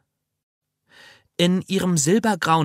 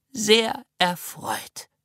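put-on old lady voice; 6 semitones higher, much slower than usual. Old lady greets slowly.

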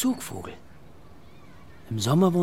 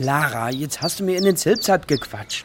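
louder, higher exasperated reproach; irritated complaint; 5 semitones higher, 5 dB louder than usual. Acted. Father annoyed that daughter spoiled his bird recording, exasperated. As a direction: scold her for spoiling the take.

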